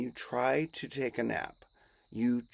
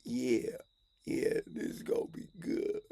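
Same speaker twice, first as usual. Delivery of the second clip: hushed anticipation; 6 dB quieter than usual. Acted. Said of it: "And they're like".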